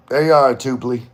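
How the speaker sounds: ordinary delivery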